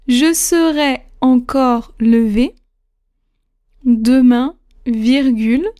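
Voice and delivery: slow, measured dictation with spoken punctuation; dictating. Shown as a demonstration. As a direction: read each phrase slowly for students to write. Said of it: "c'est parti pour cette huitième dictée". (dictée lente et articulée)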